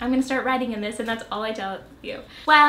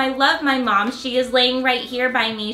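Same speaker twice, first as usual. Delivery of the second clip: louder, eager diary read; adult reading her childhood journal entry to viewers, louder and eager; reading a kid's diary aloud; 6 dB louder than usual. Acted. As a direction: read it like the proud eleven-year-old who wrote it.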